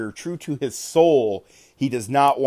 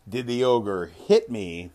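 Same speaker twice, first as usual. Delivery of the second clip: ordinary talk, nothing marked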